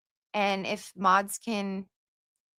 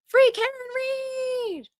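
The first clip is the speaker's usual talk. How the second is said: very high, slow, held chant; rallying cry; 3 dB louder than usual. Pretend. Reading a supporter's comment to the stream, a high, drawn-out rally cry. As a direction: call it out like a protest chant. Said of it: "Izzy says".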